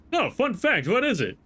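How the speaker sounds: high, bright mock eagerness; eager curiosity. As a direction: ask it like a delighted kid. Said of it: "it was like".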